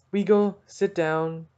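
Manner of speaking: ordinary delivery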